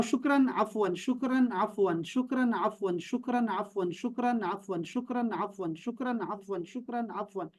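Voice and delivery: repeated softly, fading each time; rosary-style drill. Shown as a demonstration. Teacher drilling the words on prayer beads, fading chant. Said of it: "آپ نے کہنا ہے".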